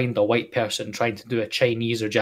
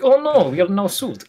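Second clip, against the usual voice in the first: higher-pitched mock Russian; Russian accent; 6 semitones higher, 3 dB louder than usual. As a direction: put on a Russian accent for a joke. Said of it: "When you speak Russian".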